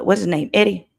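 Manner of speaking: ordinary talk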